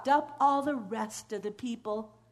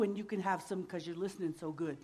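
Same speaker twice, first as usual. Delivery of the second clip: softer and fast; soft aside; 7 semitones lower, 10 dB quieter, much faster than usual. A narrator's reading. Storyteller praising the listening children softly. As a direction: slip it in as a quiet treat.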